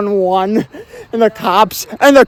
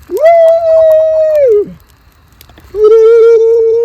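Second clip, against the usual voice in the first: long, high drawn-out "woo"; high held celebratory woo; high whoop; 10 semitones higher, 5 dB louder than usual. Real, not acted. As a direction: let out a sustained high whoop. Whooping by the bonfire.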